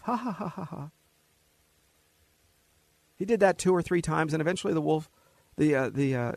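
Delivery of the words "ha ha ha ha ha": soft high staged "ha ha"; mocking laugh. Boy laughing at the sheepdog, soft high ha-ha. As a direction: laugh at the fooled dog. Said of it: "the boy would laugh".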